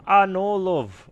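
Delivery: high, loud, slow Scottish "Ah, no, love"; drawn-out Scottish gnome sigh; Scottish accent. Acted. Gnome keeper correcting the hero, Scottish accent. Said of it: "Scottish accent".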